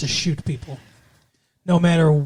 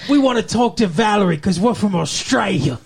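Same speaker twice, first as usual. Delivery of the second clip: a bad Australian accent, called out; mock Australian accent, loosely done; 3 semitones higher, 4 dB louder than usual. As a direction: butcher an Australian accent on purpose. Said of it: "imitate the butchering ... the Australian accent".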